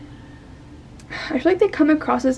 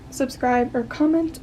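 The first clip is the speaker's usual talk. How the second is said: ordinary delivery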